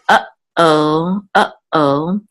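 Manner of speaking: uh-oh with a clear break between uh and oh; uh-oh with a pause. Shown as a demonstration. Teacher using English uh-oh to show the pause. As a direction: stop briefly between uh and oh. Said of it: "there's a pause between uh and oh".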